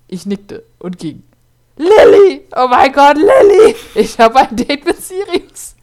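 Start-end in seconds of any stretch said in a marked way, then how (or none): 1.8-5.7 high, loud squealing shout; excited calling out. Acted. Girl tells best friend about her date, excited shout. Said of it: "rief ich meiner besten Freundin".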